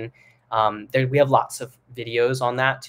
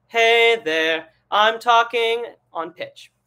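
higher, louder "Hey there" on a sustained pitch; chest voice; 10 semitones higher, 8 dB louder, much slower than usual. Shown as a demonstration. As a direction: speak "I'm talking on pitch" on one held note. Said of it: "So chest voice, just like what I did".

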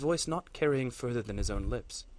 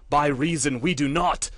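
louder defiant outburst in another voice; shouted; 4 semitones higher, 11 dB louder than usual. Acted. Silent battle lord finally defying the matriarch, loud shout. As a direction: burst out in defiance. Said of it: "shouted Lorthax".

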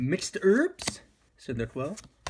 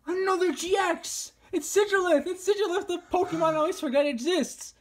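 high, excited shouting; excited yelling; 11 semitones higher than usual. Real, not acted. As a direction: shout it out in delight. Pulling a rare card, excited.

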